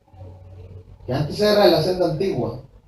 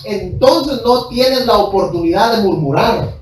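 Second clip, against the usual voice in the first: fast, raised, pounding preacher voice; fervent, fast preaching climb; preaching at full force; 4 semitones higher, 7 dB louder than usual. Acted. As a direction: drive it home from the pulpit.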